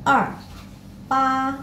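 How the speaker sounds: er and pa with tone marks; toned syllables. Shown as a demonstration. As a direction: read er and pa with their tones. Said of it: "Contohnya di sini ada kata i".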